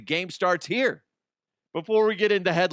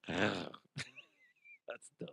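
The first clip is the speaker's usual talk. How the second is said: ordinary talk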